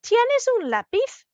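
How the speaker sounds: slow, high "Tienes un lápiz" swooping up on "lápiz"; "Tienes un lápiz" as an exaggerated question; rising question. Shown as a demonstration. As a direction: ask "Tienes un lápiz" high, with a big final lift. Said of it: "that was a question".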